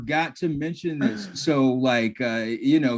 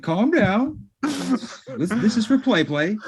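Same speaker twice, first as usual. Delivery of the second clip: higher, coaxing mock voice; mock placating; 9 semitones higher, slower than usual. Interviewer calming Pillman down, higher coaxing voice. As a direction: soothe an unhinged guest.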